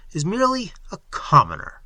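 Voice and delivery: commoner leaned on with a lift; stress on commoner. A narrator's reading. Stranger hinting to the master of ceremonies, stress on "commoner". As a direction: press the word "commoner" pointedly. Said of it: "laying a stress on the last word".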